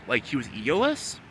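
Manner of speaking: ordinary delivery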